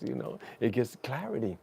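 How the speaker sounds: ordinary talk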